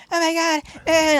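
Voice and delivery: high-pitched mock hysterical wail; hammed-up panicky cry; losing her mind. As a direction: imitate a drunk woman freaking out. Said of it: "like, losing her mind".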